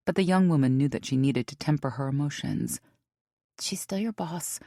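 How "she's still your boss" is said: quiet inner thought. A narrator's reading.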